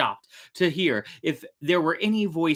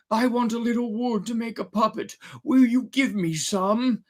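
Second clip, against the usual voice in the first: put-on old man voice; 10 semitones higher than usual. Geppetto asks for wood.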